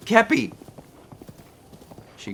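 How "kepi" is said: calling out. Real, not acted. Calling horse from afar.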